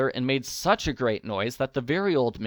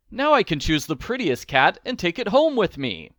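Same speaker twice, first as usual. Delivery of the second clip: higher, a little louder and happy; eager to pick the prettiest; joyful; 6 semitones higher, 4 dB louder than usual. A narrator's reading. Old man choosing a cat, joyful. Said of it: "cried the old man joyfully".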